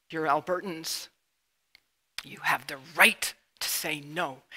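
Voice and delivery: slow, deliberate appeal with the word right leaned on; rallying speech. Real, not acted. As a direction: urge them slowly to stand up and say no.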